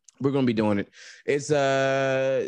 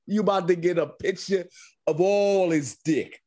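mock Jersey swagger, pitched up and laughing; lightly hammed Jersey tough talk; Jersey guy bravado; 10 semitones higher, slower than usual. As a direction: ham up a brash Jersey guy.

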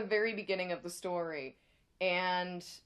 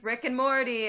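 loud; 3 semitones higher, 7 dB louder than usual. Real, not acted. Calls out show name.

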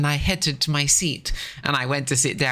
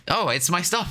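laughing quote; 7 semitones higher than usual. Retold or imitated. Retelling waking on the train.

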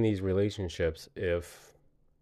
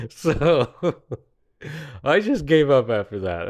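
laughing; 4 semitones higher, 6 dB louder than usual. Real, not acted. Laughing through the line.

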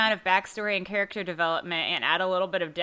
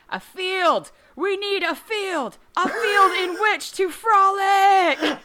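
high, drawn-out theatrical holler; mock scream; 10 semitones higher, 7 dB louder, slower than usual. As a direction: belt it out slowly like a crazed visionary. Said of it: "screams into the night".

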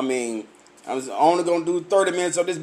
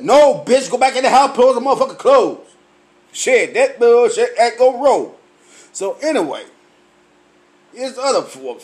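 ordinary talk, nothing marked

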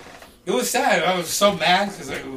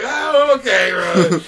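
high, loud, drawn-out agreement; retold giving-in; 3 semitones higher, 9 dB louder, much slower than usual. Agreeing to meet the Game, retold giving-in. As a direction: go along with it, pitched way up.

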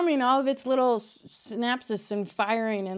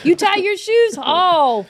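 loud, high, over-the-top awe; amazed; 6 semitones higher, 8 dB louder, slower than usual. Acted. Podcaster hailing someone tying shoes, amazed. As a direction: act blown away by something trivial. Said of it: "I'm surprised if anybody can".

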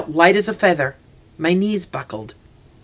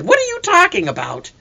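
demanding; 14 semitones higher than usual. A narrator's reading. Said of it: "A hunter grabbed Mister Benson".

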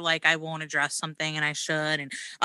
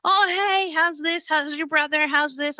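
very high, loud bubbly greeting; chirpy small-town acquaintance; 10 semitones higher, 4 dB louder than usual. Retold or imitated. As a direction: gush like an overfriendly neighbor. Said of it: "and they're like".

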